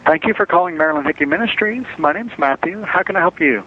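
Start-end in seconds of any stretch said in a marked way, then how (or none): none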